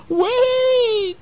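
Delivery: very high, loud squeaky wait; urgent mouse cry. Acted. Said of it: "said the mouse".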